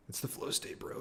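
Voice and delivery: whispered, hushed aside. Real, not acted. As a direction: whisper it like a secret.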